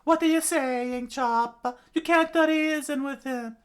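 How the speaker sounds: very high, loud protest; Kureha screaming. Acted. Doctor warning Chopper against reasoning, screamed. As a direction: screech it out in alarm. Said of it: "Kureha immediately screamed out".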